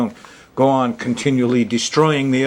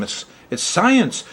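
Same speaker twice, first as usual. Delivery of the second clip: higher and slow, insisting it's science; insistent exclamation; 7 semitones higher, slower than usual. Real, not acted. Astrologer defending astrology to his viewers, insistent and emphatic. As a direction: lean hard on science, pitch up and drawn out.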